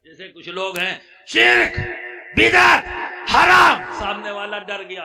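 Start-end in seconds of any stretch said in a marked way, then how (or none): 1.3-4.0 very loud, high shouted condemnations; زوردار ڈانٹ. Retold or imitated. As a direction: shout each word separately to scare the listener. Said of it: "اتنی زور سے کہا". (زور سے چلّا کر ڈراتے ہوئے)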